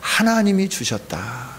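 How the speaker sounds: ordinary talk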